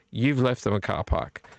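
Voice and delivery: ordinary talk, nothing marked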